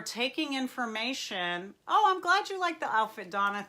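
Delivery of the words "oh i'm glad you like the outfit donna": delighted thanks. Real, not acted. Reacting to viewer comment on outfit.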